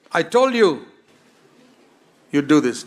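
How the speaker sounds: loud scolding I-told-you-so, pitched high; accusing shout. Pretend. Preacher voicing an accusing Jesus, a loud scolding shout. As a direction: hurl it at him like a reproach.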